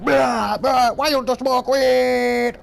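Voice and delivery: high, loud, slow goofy boss line; mock boss voice. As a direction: mimic the boss's odd taunt. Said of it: "You'd be like".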